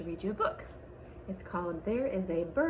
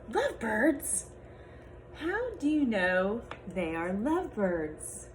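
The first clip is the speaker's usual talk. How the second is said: lively picture-book read-aloud with character voices; reading to children; 4 semitones higher than usual. Acted. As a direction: read it to a class of little kids.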